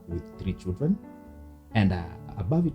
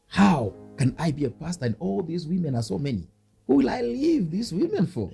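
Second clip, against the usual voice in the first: put-on dismayed young self; 9 semitones higher than usual. Man quotes his younger self balking at becoming pastor, high-pitched mock dismay. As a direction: wail it as a mock youthful protest. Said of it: "I could say".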